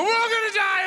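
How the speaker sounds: shrieked high and loud in mock ecstasy; climax shout. Mock climax for the audience, shrieking. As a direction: yell it out, way up high. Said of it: "that condition that makes you go".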